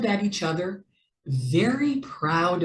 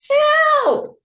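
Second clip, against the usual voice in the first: shrieking, much higher and louder cry for help; panicked squeak; 9 dB louder than usual. A narrator's reading. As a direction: scream it while sinking. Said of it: "squeaked Mouse".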